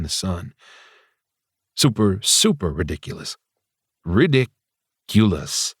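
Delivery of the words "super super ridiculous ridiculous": slow, drawn-out ridiculous; self-scolding. Acted. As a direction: stretch ridiculous out, shaking his head at himself. Chides himself.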